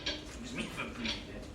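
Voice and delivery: low, soft, sulky sarcasm; sarcastic mutter after being told off. Acted. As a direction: mutter it back, offended. Sarcastic aside after rebuke.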